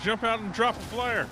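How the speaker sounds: urgent. Real, not acted. Escaping monster in game.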